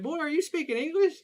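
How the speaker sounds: loud, high-pitched mom impression; baffled mom. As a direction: imitate mom's incredulous questioning. Mom questioning unintelligible friend, acted.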